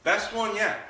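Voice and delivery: bright dog praise. Real, not acted. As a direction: lift it up to reward her. Praising dog after good rep.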